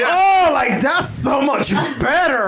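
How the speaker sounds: high, drawn-out mocking exclamation; sarcastic. Mocking friend's first car choice.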